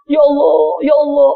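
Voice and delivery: hammed-up sobbing plea; mock crying prayer. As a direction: imitate someone crying out to God.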